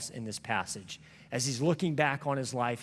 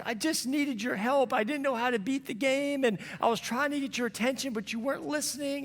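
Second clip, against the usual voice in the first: put-on upset little boy; 12 semitones higher than usual. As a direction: play the hurt six-year-old son. Son voiced in retold story.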